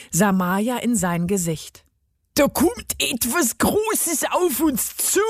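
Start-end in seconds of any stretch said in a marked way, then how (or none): 2.4-5.2 high, strained, slow gnome voice; tormented voice. A narrator's reading. Gnome warns of what is coming, tormented. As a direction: squeeze it out slowly as if in agony. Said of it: "mit gequälter Stimme".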